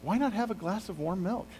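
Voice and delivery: high, sing-song offer of milk; a sugary host, luring a tired guest; Jael coaxing. Acted. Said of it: "And she goes".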